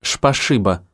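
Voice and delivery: «Шпашиба» with a slushy lisp, s as sh. Acted. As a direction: lisp «Шпашиба» through a damaged tongue. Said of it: "шепеляво произнесла".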